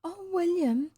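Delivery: put-on high, concerned child voice; solicitous little girl. A narrator's reading. Girl worried about boy's throat, solicitous. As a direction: fuss over him girlishly. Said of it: "Her solicitude was flattering".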